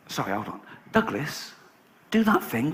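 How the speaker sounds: quiet, urgent instructions at the table; hushed urging. Retold or imitated. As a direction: lean in and press him under your breath. Urging Douglas at casino.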